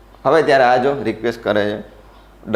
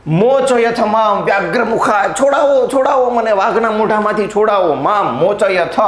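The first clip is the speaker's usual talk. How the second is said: pleading cry; 6 semitones higher than usual. Acted. Acting character pleading, tiger attack.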